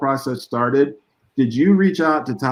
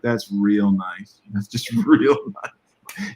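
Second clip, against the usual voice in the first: slow, drawn-out mock approval; sarcastic mother; 2 semitones lower, much slower than usual. Storyteller imitates the ballplayer's unimpressed mother, slow sarcasm. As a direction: stretch it out with dry sarcasm. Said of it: "the sarcastic kind of look".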